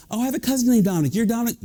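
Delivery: high, rapid, excited chatter; mock-eager small talk at double speed; chatty acquaintance. Acted stranger greeting him by wrong name.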